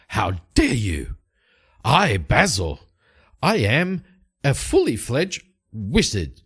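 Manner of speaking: affronted, theatrical, swooping pitch; pompous wounded pride, slowly proclaimed; indignant mage. Acted. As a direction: puff up and declaim each phrase separately. Insulted mage protests his rank.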